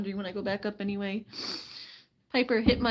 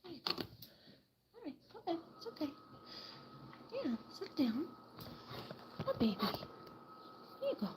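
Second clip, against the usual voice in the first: much softer, higher there you go; breathy soft higher coaxing to a pet; hushed coaxing; 7 semitones higher, 9 dB quieter than usual. Real, not acted. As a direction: coax the hen to sit down gently.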